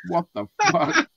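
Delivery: very high, incredulous retelling; amused disbelief. Retold or imitated. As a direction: recount the shock on the edge of laughter. Retelling his shock, breaking into laughter.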